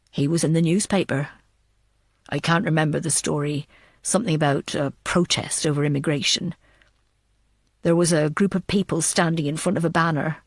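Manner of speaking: put-on low, steady telling of an old memory; a hint of a cool, unhurried man; Samuel's voice.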